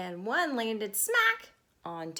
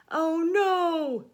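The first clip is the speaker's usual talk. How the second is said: higher and louder dismayed groan; 6 semitones higher, 8 dB louder than usual. Acted. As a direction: wail it in dismay. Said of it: "Tony groaned".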